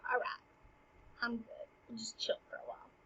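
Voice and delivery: very soft, half-whispered mellow voice; chilled out. Acted. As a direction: settle down to a near whisper. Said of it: "he'll chill out".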